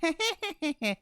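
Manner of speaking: high, put-on polite titter; fake little laugh. Barmaid covers for not knowing J J, fake little laugh. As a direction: give a small, fake social laugh. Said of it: "Just a little, little fake, like".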